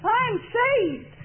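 very high mock little-girl voice. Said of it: "one little girl jumps up".

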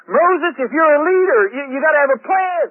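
acted exasperated crowd confronting its leader; demanding. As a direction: press the leader hard for an answer. People demand a plan from Moses.

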